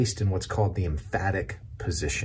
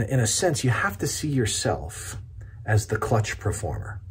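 usual speaking voice

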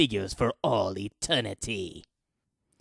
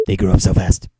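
loud proud declaration; 2 semitones lower, 10 dB louder, much faster than usual. Acted. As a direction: belt it out proudly. Repeated line, loud take.

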